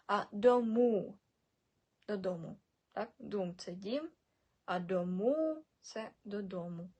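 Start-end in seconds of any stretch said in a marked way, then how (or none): 0.3-1.2 дому as "do-muuu", ending held long; long final u. Shown as a demonstration. Teacher showing learners, дому with long drawn ending. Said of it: "у з кружком". 4.8-5.6 дому with the last "u" held and rising; long final u. Shown as a demonstration. Teacher contrasting two words, дому with long ending. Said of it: "у з кружком".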